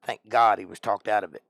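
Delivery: ordinary delivery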